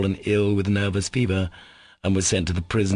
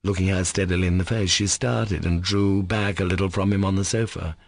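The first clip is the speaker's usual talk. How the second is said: usual speaking voice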